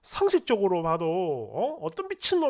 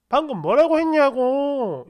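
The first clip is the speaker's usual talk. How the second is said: louder, drawn-out angry insistence, retold; furious demand; 4 semitones higher, 5 dB louder than usual. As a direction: press the question with mounting outrage. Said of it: "미친 듯이 화를 내더라고요". (화가 나서 목소리 높여 다그치듯)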